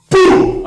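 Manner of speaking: impact sound effect. Retold or imitated. Storyteller acting out his elbow strike, loud breathy boom. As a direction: punch out the hit sound.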